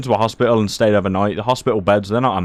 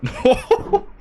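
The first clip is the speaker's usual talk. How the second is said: short high burst of laughter. Real, not acted. Laughing at the memory of the foot cannula, high laugh. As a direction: laugh it off, pitched way up.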